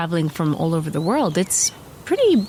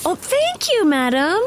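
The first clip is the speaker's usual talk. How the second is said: gushing high voice; 8 semitones higher than usual. Pretend. Playing along with madam.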